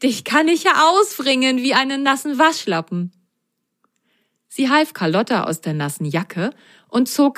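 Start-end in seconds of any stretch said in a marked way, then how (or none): 0.0-3.1 a light laughing mum voice; amused mother. Acted. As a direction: tease the soaked child fondly. (amüsierte Mama)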